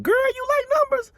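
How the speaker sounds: put-on high, excited girlfriend voice; voicing Beverly. Beverly asking her new roommate in a high, delighted voice. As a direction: squeal it like a delighted friend. Beverly asks Alice about numbers.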